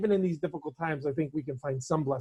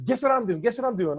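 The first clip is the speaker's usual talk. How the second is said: higher, excited retelling of an eager caller; enthused; 7 semitones higher, 4 dB louder than usual. Retold or imitated. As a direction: imitate someone bursting with news. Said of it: "He was enthused".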